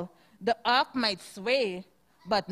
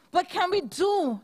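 raised, higher call for help; Noah shouting; 8 semitones higher than usual. Acted. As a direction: shout to the whole ark. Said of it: "Noah asked with a shout".

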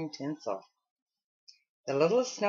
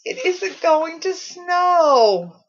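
high, loud, slow sobbing; 11 semitones higher, 10 dB louder, much slower than usual. A narrator's reading. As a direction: sob the words out. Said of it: "sobbed the Weatherman".

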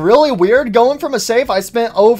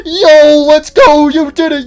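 excited cheer; 7 semitones higher, 9 dB louder, slower than usual. Real, not acted. Gamer cheers himself for winning.

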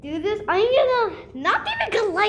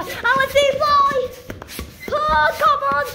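exasperated whine; 6 semitones higher than usual. Real, not acted. Child urging a balloon that won't fly, exasperated high cry. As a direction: plead with rising impatience.

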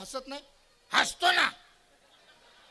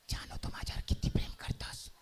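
put-on whispered girlfriend voice. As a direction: whisper like a girlfriend hiding under the sheet. Girlfriend asks coyly.

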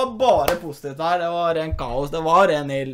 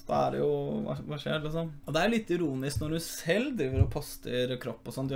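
normal talking voice

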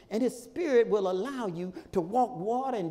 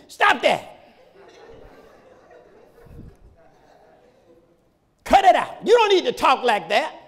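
loud, high-pitched preacher's rebuke; scolding shout; 4 semitones higher, 8 dB louder than usual. Real, not acted. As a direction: scold the congregation at full voice. Preacher scolds congregation about cursing.

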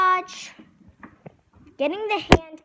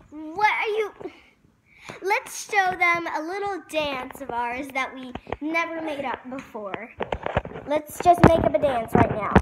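ordinary talk

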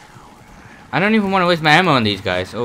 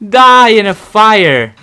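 loud high gloating shout; triumphant angry yell; 8 semitones higher, 10 dB louder, much slower than usual. Real, not acted. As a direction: scream it at the boss. Triumphant yelling at boss.

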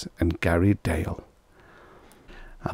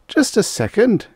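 high, urgent interjection; interrupting; 13 semitones higher, 4 dB louder than usual. A narrator's reading. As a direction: cut in to protest. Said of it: "Cal interrupted".

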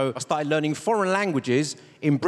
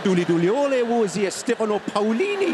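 Chinese in a louder Geordie accent; 4 semitones higher, 4 dB louder than usual. Shown as a demonstration. Comic speaks Chinese with a Geordie accent. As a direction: Newcastle sing-song on the Chinese. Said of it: "Chinese with a Geordie accent".

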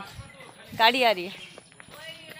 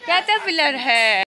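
slow, louder "caterpillar है" near usual pitch; drawn-out confirmation; 5 dB louder than usual. Real, not acted. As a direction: spell it out slowly and firmly. Repeats the word for viewers.

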